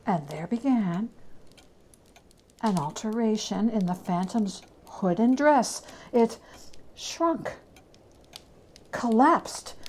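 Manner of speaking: ordinary delivery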